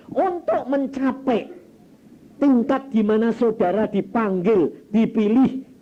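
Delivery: slow, loud, high proclamation; stretched, ringing sermon declaration; emphatic preaching. Real, not acted. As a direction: drive the point home from the pulpit. Preacher raises voice for key point.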